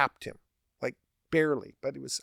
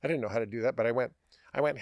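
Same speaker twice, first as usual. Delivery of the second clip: ordinary talk, nothing marked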